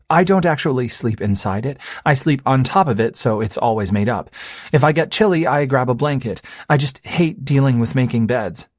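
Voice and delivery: lightly voiced earnest girlfriend; young woman voice. Dorothy's lines voiced by narrator.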